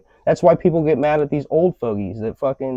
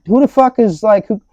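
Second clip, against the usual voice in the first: high, loud indignant rant; ranting about customers who inspect the roof; ranting outburst; 9 semitones higher, 5 dB louder than usual. Retold or imitated. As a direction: blow up at the absurdity.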